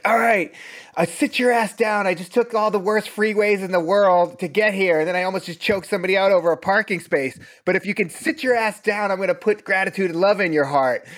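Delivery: teacher voicing how he greets a tired class, pumped up; mock tough East Coast rant. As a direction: bark it at the late arrivals, half joking. Teacher voicing his own arrival rant.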